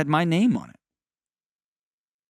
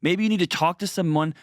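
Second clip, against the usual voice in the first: bright, higher sponsor-read voice; 5 semitones higher than usual. Acted. Host reading the BetterHelp sponsor spot as an upbeat ad. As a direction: sell it like a podcast ad, upbeat.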